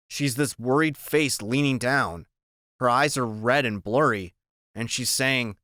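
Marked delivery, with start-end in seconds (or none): none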